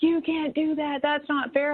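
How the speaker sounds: a mild retold panic from a friend; scene: friend warns her not to confront the teacher, mildly alarmed; friend freaking out. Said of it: "was freaking out".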